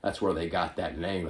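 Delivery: usual speaking voice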